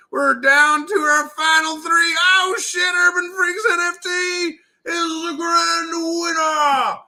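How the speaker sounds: excited announcer. Real, not acted. Announcing the winner of the round.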